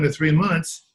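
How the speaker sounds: ordinary talk, nothing marked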